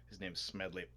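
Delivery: normal talking voice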